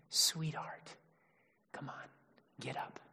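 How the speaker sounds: hushed, soft and affectionate; Jesus gently waking the little girl, whispered; tender whisper. Retold or imitated. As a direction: coax her awake in a whisper. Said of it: "No, he's saying".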